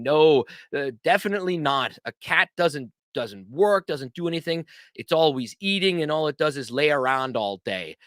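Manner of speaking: normal talking voice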